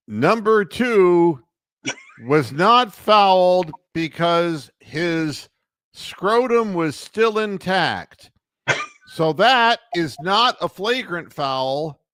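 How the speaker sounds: mock solemn replay ruling, very slow; deadpan referee announcement. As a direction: soulless dead-man stare into the camera. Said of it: "give that soulless dead man look into the camera".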